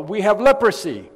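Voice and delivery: loud high warning shout; desperate outcast yelling to keep people back; lepers yelling warning. Retold or imitated. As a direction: shout them away from you. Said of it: "they would yell".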